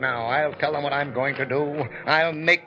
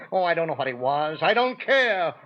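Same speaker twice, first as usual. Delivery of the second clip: ordinary talk, nothing marked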